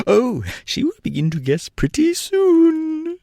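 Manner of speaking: high and cheerful, slowly; a tiny tyrant delighted with himself; high, slow, cheerful gnome king. A narrator's reading. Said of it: "said the king cheerfully".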